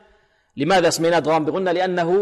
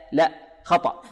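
ordinary delivery

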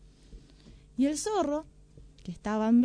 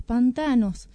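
usual speaking voice

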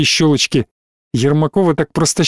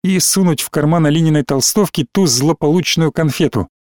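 ordinary delivery

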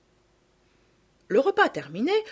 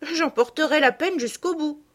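cheerfully; 8 semitones higher than usual. Acted. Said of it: "dit Sophie avec gaieté".

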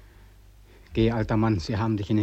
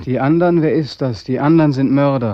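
angry outburst; 3 semitones higher, 8 dB louder than usual. Acted. Beckmann rejects the Other's claim.

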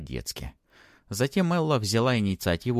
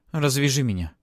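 put-on raised, higher girl's demand; insistent demand; 7 semitones higher, 6 dB louder than usual. A narrator's reading. Tied-up girl to Matvey, insistent demand. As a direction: demand it loudly and firmly. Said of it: "потребовала Элла".

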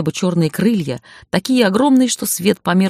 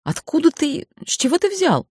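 much higher, flustered surprise; 11 semitones higher than usual. A narrator's reading. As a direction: caught out and embarrassed. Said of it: "ошеломлённо моргнула и покраснела".

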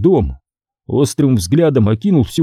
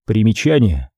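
ordinary delivery, nothing marked

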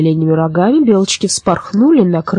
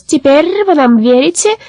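gloating raven; 9 semitones higher than usual. A narrator's reading.

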